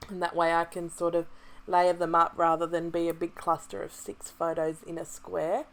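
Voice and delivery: usual speaking voice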